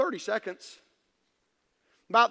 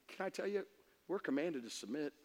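ordinary talk

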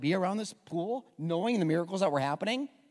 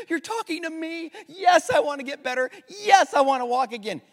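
much higher and louder, laughing with joy; eager yes; 8 semitones higher, 8 dB louder than usual. Retold or imitated. Healed man overjoyed that Jesus picked him, laughing. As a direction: burst with thrilled gratitude. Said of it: "what I thought I would read is like".

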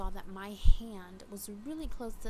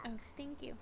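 ordinary delivery

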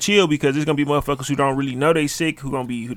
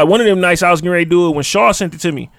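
usual speaking voice

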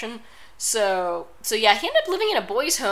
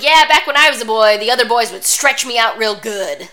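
loud, hammed-up old-timer impression; exaggerated imitation, much louder than usual talk; Stu Hart; 3 semitones higher, 12 dB louder than usual. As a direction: boom it out as a mock reminiscence. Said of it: "he's like, he's like".

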